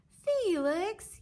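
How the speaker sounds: high, loud sharp call of a name; yelled. Acted. Mom yelling at Felix over spilled cereal. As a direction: yell the boy's name to stop him. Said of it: "yelled his mom".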